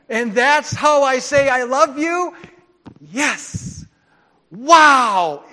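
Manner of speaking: loud, high-pitched incredulous outburst; astonished. Acted. Preacher acting his teenage self learning how to show love, astonished. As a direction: exclaim it in loud disbelief. Teen's astonished reaction, acted out.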